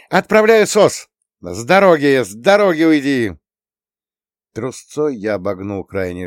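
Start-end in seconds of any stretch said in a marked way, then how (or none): 0.1-3.3 high, loud clear-the-way shout; urgent shout. A narrator's reading. Drone crew clears the road, shouting urgently. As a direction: shout them out of the path.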